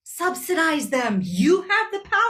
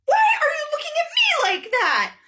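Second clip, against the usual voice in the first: much higher, defensive question; defensive whine; 11 semitones higher than usual. Acted. The leader whining at Brazil in a much higher voice. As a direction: whine it like someone caught out.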